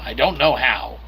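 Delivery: ordinary talk, nothing marked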